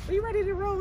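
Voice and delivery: high put-on girl voice; squeaky falsetto girl voice; man doing a woman's voice. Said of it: "we gotta practice our girl voice".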